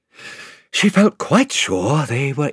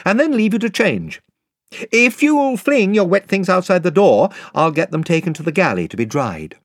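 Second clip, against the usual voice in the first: put-on higher, eager young king's voice; Caspian; 8 semitones higher than usual. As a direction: voice the young king, pitched up and helpful. Caspian speaks to Lucy.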